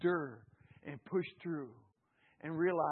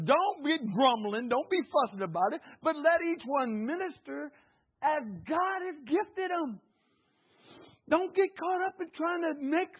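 preaching fervor; 9 semitones higher, 6 dB louder than usual. Acted. Preaching at full force.

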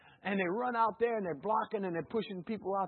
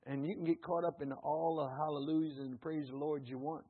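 ordinary delivery

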